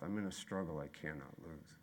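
a low murmured promise; hushed refrain. Real, not acted. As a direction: let it sink almost to nothing. Refrain fading lowest.

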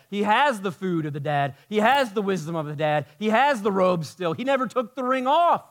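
loud, high, driving sermon delivery; full-voiced pulpit preaching; preaching at full force. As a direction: preach it out big to the room. Preaching on the older brother.

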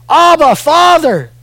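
crying out. Acted.

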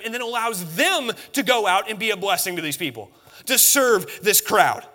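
high, loud pulpit delivery; impassioned preaching. Acted. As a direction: drive it home to the congregation. Preaches at full force.

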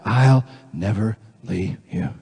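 low, soft, tender promise; God's reassurance. Acted. God's reply to each confession.

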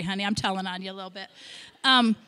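normal talking voice

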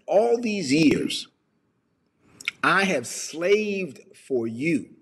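slow, drawn-out grievance; acted long-suffering complaint; angry older son. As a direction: stretch each word with bitter resentment. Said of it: "the older brother was angry".

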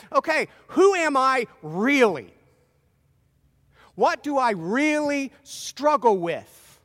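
loud, slow, driving sermon; preaching at full force. Acted. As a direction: hammer each warning home to the congregation. Preaches Satan knows your weaknesses.

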